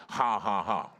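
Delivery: deliberate, spoken-out laugh; mock laugh made by will; faith laugh. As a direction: force out each "ha" on purpose. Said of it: "So he began to faith laugh".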